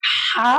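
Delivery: হা pressed from the throat. Shown as a demonstration. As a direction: squeeze হা from the throat. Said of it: "হাটা গলায় চাপ দিয়ে বলুন". (গলায় চাপ দিয়ে হা)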